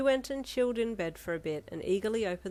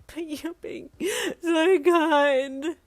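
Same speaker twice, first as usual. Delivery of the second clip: whispered then high loud crying wail; 9 semitones higher, 9 dB louder, much slower than usual. A narrator's reading. Yin wailing at Ida through tears. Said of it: "you wailed".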